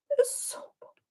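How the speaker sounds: high, soft whispered awe; breathy whisper. Real, not acted. Astrologer whispers to viewers, awed by March.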